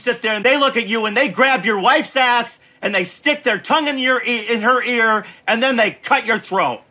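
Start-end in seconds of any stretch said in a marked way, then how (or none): none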